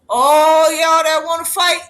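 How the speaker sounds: loud slow summons to fighters; mock call-out. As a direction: call them into the ring. Calling out warmongers in quote.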